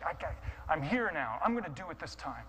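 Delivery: ordinary delivery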